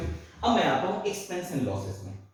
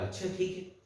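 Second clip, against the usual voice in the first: hushed reply; 10 dB quieter than usual. Retold or imitated. Retold worried reply on phone.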